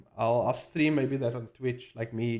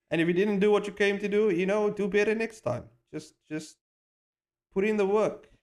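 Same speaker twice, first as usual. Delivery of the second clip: higher and faster, pushing; urgent push; 8 semitones higher than usual. Real, not acted. Vlogger urging artists, high fast push. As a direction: fire them up to work.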